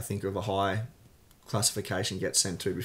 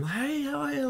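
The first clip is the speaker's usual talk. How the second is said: much higher, chirpy mock greeting; overeager hello. Retelling meeting cellmate, a mock overeager hello. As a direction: imitate your naive, cheery hello. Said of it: "like that".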